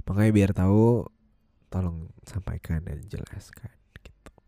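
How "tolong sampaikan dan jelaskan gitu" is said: soft whispered aside; whispering. Real, not acted. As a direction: whisper it like a secret.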